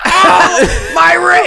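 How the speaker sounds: put-on high, loud pained old-man cry; hammed-up high pained yelp. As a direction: yelp like a frail old man hurting his wrist. Old hero hurts wrist.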